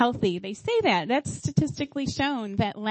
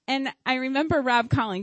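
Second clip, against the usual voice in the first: ordinary delivery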